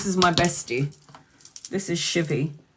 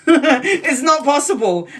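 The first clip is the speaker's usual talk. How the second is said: slow, higher and louder, laughing through it; 5 semitones higher, 4 dB louder, slower than usual. Real, not acted. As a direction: laugh as you repeat it.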